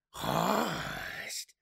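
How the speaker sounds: demon hissing with pleasure. A narrator's reading. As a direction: hiss the name like a gleeful demon. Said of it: "hissed the demon thing in undisguised pleasure".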